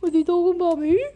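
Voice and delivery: high, loud, puzzled dog voice; voicing the dog. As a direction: give the dog a high curious voice. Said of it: "And she's like".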